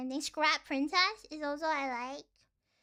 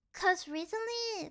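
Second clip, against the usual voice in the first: usual speaking voice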